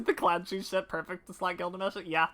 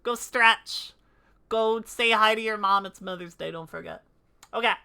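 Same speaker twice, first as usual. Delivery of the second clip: ordinary talk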